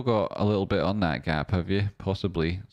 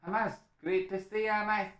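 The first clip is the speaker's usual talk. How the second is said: high excited greeting; 14 semitones higher, 5 dB quieter than usual. Real, not acted. Greeting a viewer.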